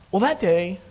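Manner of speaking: normal talking voice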